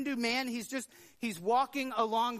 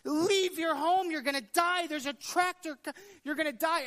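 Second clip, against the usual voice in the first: frantic yelling; 8 semitones higher, 4 dB louder than usual. Retold or imitated. Man in the field yelling at ants to flee, frantic shout. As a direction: yell a warning to save them. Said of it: "he starts yelling at the ants".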